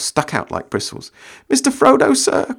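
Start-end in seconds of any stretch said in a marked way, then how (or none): 1.5-2.6 high, loud frightened cry; Sam quaking. Acted. Sam begging Frodo for protection, high frightened cry. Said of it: "cried Sam, quaking".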